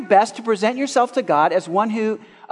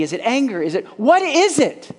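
ordinary delivery

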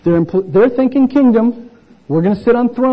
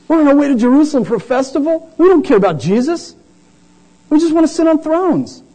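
higher, faster mocking of the disciples; higher sarcastic disciple voice; 8 semitones higher than usual. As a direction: sneer at their selfish aims.

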